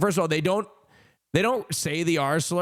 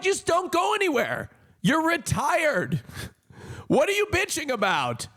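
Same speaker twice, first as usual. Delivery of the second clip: high, incredulous scolding; mock exasperation; 10 semitones higher than usual. Retelling his nagging of retired dad about traffic, mock exasperated. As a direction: plead at dad in high-pitched disbelief.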